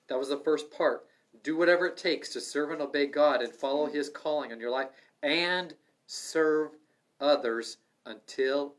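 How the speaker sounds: loud, fervent preaching; rising sermon cry. Acted. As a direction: preach it out with fire. Preaching at full force.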